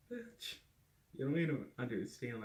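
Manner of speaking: incredulous outburst. Retold or imitated. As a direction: jump way up in disbelief, then push the rest out. Reply to friend's support.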